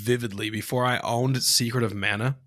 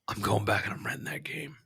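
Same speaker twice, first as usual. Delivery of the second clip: near-whispered plan, imitating kid self; much softer, whispery self-talk; hushed resolve; 3 semitones lower, 9 dB quieter than usual. Kid plotting the next rental, hushed resolve. Kid plans to rent again.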